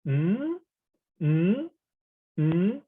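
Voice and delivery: "mm" sliding up high like a question; rising tone. Shown as a demonstration. Teacher imitating someone asking a question, rising tone. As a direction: hum "mm" upward, as if asking. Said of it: "is the rising tone".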